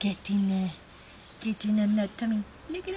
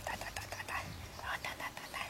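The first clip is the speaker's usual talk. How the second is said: soft whispered babble; whispering; 11 dB quieter, much faster than usual. Real, not acted. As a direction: breathe the syllables softly.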